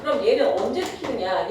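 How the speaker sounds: ordinary delivery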